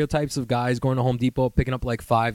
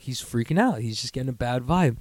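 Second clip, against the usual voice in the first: usual speaking voice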